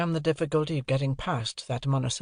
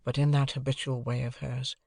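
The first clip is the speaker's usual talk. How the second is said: ordinary delivery, nothing marked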